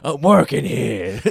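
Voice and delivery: slow, drawn-out angry yell; mock tantrum. Actor berating an intern during rehearsal, mock tantrum. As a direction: stretch each word in a hammed-up bellow.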